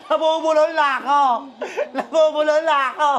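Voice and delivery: real laughter through the words; giggling, breaking into laughs; laughing at own stumble. Real, not acted. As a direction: crack up at your own tongue-tie.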